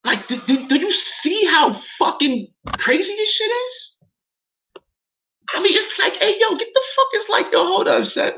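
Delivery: high, incredulous near-shout of disbelief; outraged disbelief. Real, not acted. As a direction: voice jumps up in outrage at the absurdity.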